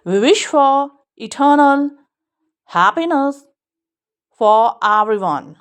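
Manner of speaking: very slow, each word drawn out; slow model reading. Shown as a demonstration. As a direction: read the sentence slowly for the class. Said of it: "model sentence read".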